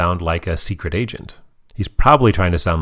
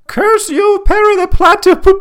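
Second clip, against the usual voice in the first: very high, loud muffled "curse you" cry; Doofenshmirtz from inside a cave; 8 dB louder than usual. Retold or imitated. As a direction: shout the villain's curse as if stuck inside a cave. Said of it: "hear Doctor Doofenshmirtz from inside the cave say".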